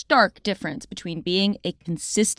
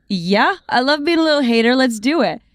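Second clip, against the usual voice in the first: playful eagerness; 6 semitones higher, 3 dB louder than usual. Retold or imitated. Gleefully joins kid's venting, retold.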